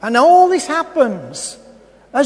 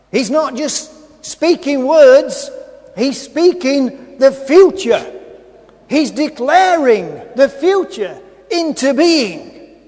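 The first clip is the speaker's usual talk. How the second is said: emphatic preaching; 5 dB louder than usual. Real, not acted.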